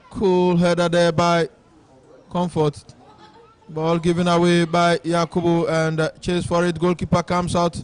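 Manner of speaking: steady match commentary; live play-by-play. Real, not acted. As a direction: call the game as it happens.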